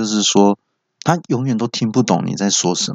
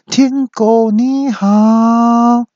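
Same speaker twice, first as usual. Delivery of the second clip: very high, long-drawn holler; loud shout; 5 dB louder, much slower than usual. Retold or imitated. Owner shouts right beside unresponsive radio, loud. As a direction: bellow it, stretching every syllable. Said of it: "就大声喊说".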